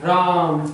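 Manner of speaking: राम called out with a long आ; drawn-out राम, as a call; calling, prolonged. Shown as a demonstration. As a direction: call someone, stretching राम. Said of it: "कैसे बुलाऊंगा".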